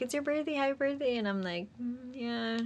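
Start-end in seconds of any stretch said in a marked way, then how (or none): 0.0-1.2 high-pitched imitation of an upbeat friend; mock-chirpy birthday wishes; cheery friend. 1.8-2.6 soft, higher noncommittal hum; retold reluctant agreement; unenthusiastic. Retold unenthusiastic reply to friend.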